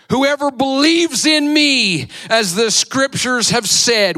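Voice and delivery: high, loud proclaiming call. Acted. Jesus calls out to the crowd in a loud voice. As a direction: cry it out to the festival crowd. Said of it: "and said in a loud voice".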